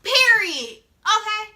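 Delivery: loud punchy period; sassy emphasis. Real, not acted. Vlogger ending the argument, loud and punchy. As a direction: slam it shut.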